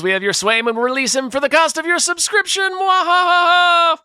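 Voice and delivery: gloating villain, high and loud; sneering panto villain. Pretend. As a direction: cackle like a cartoon baddie. Villain ransom joke in thanks.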